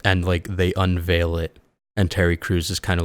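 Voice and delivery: ordinary talk, nothing marked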